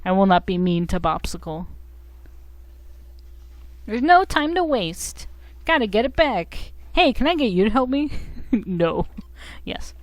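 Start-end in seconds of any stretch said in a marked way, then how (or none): 3.9-8.1 high, hurried plea; urgent game hero. Acted. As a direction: beg for help as the little game hero. Acting urgent plea from game character.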